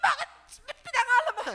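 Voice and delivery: high, loud mock outburst from his mother, half laughing; imitating angry mother. Preacher imitating his mother scolding his father over the name, high and loud mock anger. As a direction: play the furious mother, hammed up with a laugh. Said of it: "galit na galit yung aking ina".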